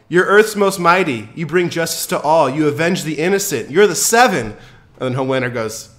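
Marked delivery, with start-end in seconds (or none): 0.1-4.5 high, loud desperate pleading; dreams crushed. Retold or imitated. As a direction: plead with shattered idols. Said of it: "she's just blown away".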